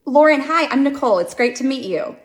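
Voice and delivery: loud, bright hello; chirpy fake greeting. Mom greeting a new parent too brightly. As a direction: gush a cheerful intro.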